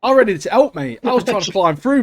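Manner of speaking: loud, high burst of eager excitement; like you'd leap into the screen to help; excited. Retold or imitated. Recalling wanting to help hero.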